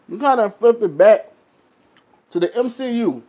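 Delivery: talking through a mouthful of food; chewing while talking; mouth full. Real, not acted. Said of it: "Sorry, I'm eating a little bit".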